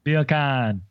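a light put-on Jamaican accent for beer can; mock Jamaican accent. Joking to friends in a mock Jamaican accent. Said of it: "word I can say in a Jamaican accent".

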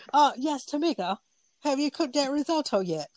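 high, impatient celebrity chef voice; demanding head chef checking on you; Gordon Ramsay. Retold or imitated. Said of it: "I don't want him to be like".

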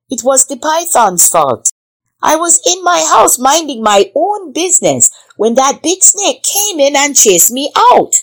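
high-pitched little rabbit pleading its case; timid rabbit. A narrator's reading. Rabbit defends itself before the king, timid and high. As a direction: pipe up high as a small creature blaming someone else. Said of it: "The timid little creature".

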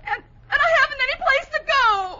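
high, sobbing comic wail; crying. Acted. Bessie admits she has no place to go, wailing. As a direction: build to a bawl at the end. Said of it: "Why are you crying?".

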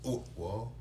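ordinary talk, nothing marked

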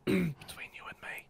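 whispering. Acted. Conspiratorial aside in character.

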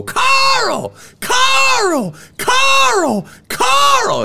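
very high screeched shout; calling out. Acted. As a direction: yell the name in falsetto, drawn out. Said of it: "he heard something go".

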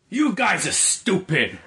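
put-on thick Philly accent. Chuck defends his Disney favourite at the table, thick Philly accent. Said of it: "in his, like, thick, like, Philly accent".

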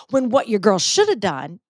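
usual speaking voice

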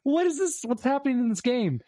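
high, baffled double take at a strange title; mock-shocked, voice jumping up; baffled what. Scrolling past a weird Reddit title, shocked and high. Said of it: "I'm like".

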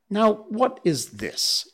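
high, slow puzzled question; confused boy under a hail of stones; bewildered. Acted. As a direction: ask as if nothing makes sense.

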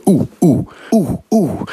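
short, high "ooh" hoots; repeated high, falling hoots; acoustic test hoots. Retold or imitated. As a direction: hoot little high oohs to test the echo. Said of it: "I'm sort of going".

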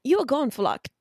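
amateur put-on English accent; bad English accent. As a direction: have a clumsy go at an English accent. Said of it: "do this in English accent".

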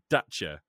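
Dacia said slowly with a falling tone; pronounced as Top Gear presenter. Retold or imitated. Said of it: "he pronounce it".

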